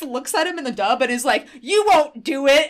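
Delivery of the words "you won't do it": high, loud, slow dare; imitated defiant yell. Taya daring the henchman, imitated defiant yell. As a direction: stretch out the taunt in a raised voice. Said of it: "keeps yelling".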